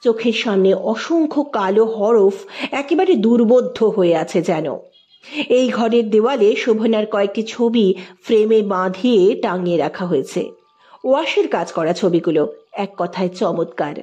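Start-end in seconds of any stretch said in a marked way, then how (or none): none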